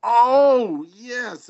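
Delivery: high, loud whoop of joy; triumphant cheer. Retold or imitated. Proud friend cheering a buddy's big news, loud whoop. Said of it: "And I was just like".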